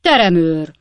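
loud, commanding summons; calling out. A narrator's reading. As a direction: call sharply for the court usher. Judge summons the usher.

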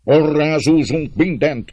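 slow, high, loud threat; shouted threat. A narrator's reading. Another rioter threatening the household, shouting. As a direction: threaten them at full voice. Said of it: "kiáltott egy másik".

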